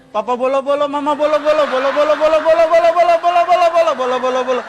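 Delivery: building from a light chant to a loud, high babble; mock Tinatun tongues. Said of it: "Bahasa lidahnya persis Tinatun". (menirukan bahasa lidah ala Tinatun, makin keras dan tinggi)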